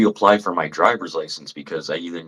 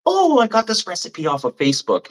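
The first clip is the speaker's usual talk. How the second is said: very high, louder put-on voice; mocking stepmom; 5 dB louder than usual. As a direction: chirp it like an eager stepmom with a new recipe. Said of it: "she used to be this, like".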